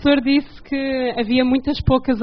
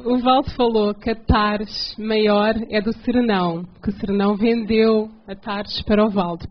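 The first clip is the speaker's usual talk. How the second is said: slow, careful phrasing for a listener who can't follow; slow, measured explanation; deliberately slow. Real, not acted. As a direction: spell it out slowly, phrase by phrase. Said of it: "Eu vou falar bem devagar".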